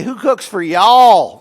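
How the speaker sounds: slow loud owl hoot, y'all stretched. Retold or imitated. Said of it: "And then say".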